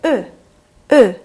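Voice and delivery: 으 said with rounded lips, an e sound held inside; French eu vowel. Shown as a demonstration. Teacher models the eu vowel twice for students, lips rounded. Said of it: "입 모양은 오인데 안에서 에 발음을".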